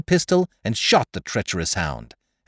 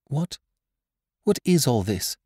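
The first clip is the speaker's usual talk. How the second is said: put-on lightly raised voice of a startled girl; hint of a girl's higher voice; 5 semitones higher than usual. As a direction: break in with a puzzled demand. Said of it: "the girl's voice thrust in".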